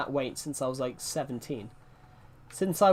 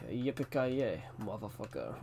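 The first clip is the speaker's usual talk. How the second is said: movie villain quote; 4 semitones lower, 9 dB quieter than usual. Retold or imitated. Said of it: "I didn't get that one right".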